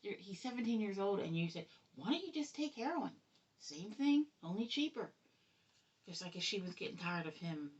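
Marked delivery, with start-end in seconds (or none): 1.9-5.1 much higher, mocking replay of the coworker's words; incredulous mocking quote. Mother mocking the coworker who offered her son heroin, incredulous and high. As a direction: repeat her words back in shocked disbelief.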